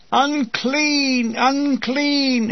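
high, drawn-out mock wail; playful cry of agony. A narrator's reading. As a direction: cry it out like a leper's warning, hammed up. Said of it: "crying in mock agony".

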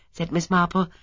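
ordinary delivery

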